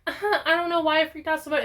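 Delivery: mock Jody. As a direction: rattle it off high like a flustered mom backpedaling. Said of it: "she's like".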